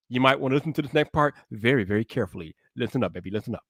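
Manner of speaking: low, soft and hushed, drawing the viewer in close; hushed warning. Acted. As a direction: lean in and murmur the warning low before the blast. Hushed warning before a loud intro.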